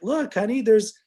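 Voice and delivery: high-pitched take on an eager wife; imitated wife. Wife telling husband about fellow nerds, imitated high voice.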